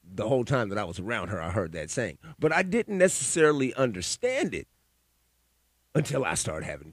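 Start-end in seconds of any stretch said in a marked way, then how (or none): none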